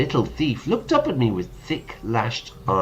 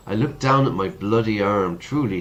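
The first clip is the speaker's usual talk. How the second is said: ordinary talk, nothing marked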